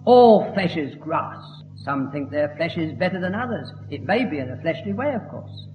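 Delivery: ordinary talk, nothing marked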